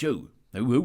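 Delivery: usual speaking voice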